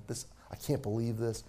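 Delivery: low, soft grumble; complaining. Retold or imitated. Complaining to the people in line, low grumbling. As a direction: complain under your voice, pitched well down. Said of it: "I'm complaining to them".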